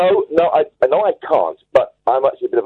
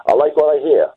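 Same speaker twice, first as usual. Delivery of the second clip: put-on slightly higher quoted line in an American accent; 4 semitones higher than usual. Caller quoting record producer Joe Meek, in an American accent. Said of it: "saying it in an American accent".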